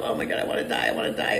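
breathy whispered groan; hammed-up whispered despair; mock despair. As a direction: whisper it like you're done for. Acting out despair in a valley.

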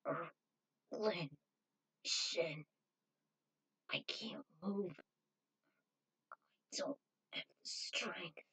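faint, breathless whisper; barely able to speak, pushing out each word; weak whisper. Acted. Paralyzed Spike whispers to spirits, weak faint whisper.

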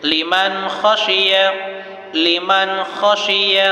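লিমান খসিয়া slow, the ন of লিমান said clearly before খ with no nasal hum; clear izhar recitation. Shown as a demonstration. Teacher shows students how he reads it, slow and clear. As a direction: say লিমান's নুন crisply, no humming. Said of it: "যেমনটি আমি পড়ছি।".

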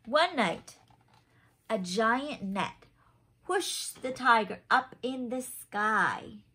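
lively picture-book narration; reading to children. A narrator's reading. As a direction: read it like a bedtime story for little ones. Reads picture book aloud.

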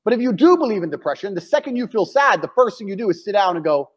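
ordinary delivery

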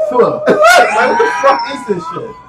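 high-pitched yelp breaking into laughter; retold disgusted outburst. Telling friends about the vegan burger, high laughing outburst. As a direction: squeal it through laughing.

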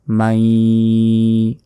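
মাই held long through the nose; long nasalised মাই; ghunnah nasal hold. Shown as a demonstration. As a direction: hum and stretch মাই nasally. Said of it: "গুণা হবে".

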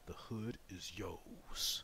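slow, hushed whisper; a gamer whispering a victory line; dramatic whisper. Acted. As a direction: breathe it like a secret.

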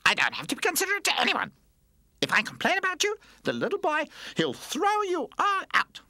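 put-on very high, loud baby tantrum voice; defiant baby toy, bratty. A narrator's reading. Baby toy threatens the other toys, defiant brat voice. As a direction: threaten them in a loud, piercing little voice.

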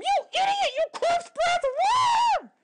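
very high, furious screaming, slowing at the end; screaming insults. Acted. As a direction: spit the insults at him in a rage. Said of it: "she screamed".

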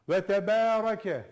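Ve tebarake with a heavy ra, slow and loud; thick ra. Shown as a demonstration. Teacher showing the thick ra in tebarake. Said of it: "Ra'yı kalın okuyacaksın".

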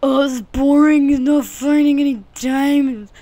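loud, high mocking whine; mock a bored viewer; a hammed-up whining viewer; bored viewer complaint. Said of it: "I'd be like".

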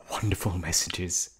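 ordinary delivery

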